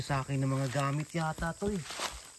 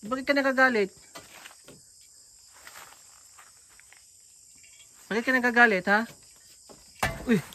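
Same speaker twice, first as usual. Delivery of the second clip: calling out; 5 semitones higher, 4 dB louder than usual. Real, not acted. Pleading with angry woman.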